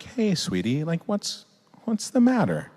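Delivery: tender parent. Acted. Parent with crying child on lap, tender. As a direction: coax gently, as to a small child. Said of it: "pick him up and I have him on my lap".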